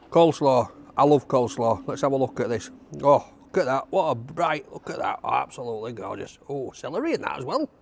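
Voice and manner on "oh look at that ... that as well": usual speaking voice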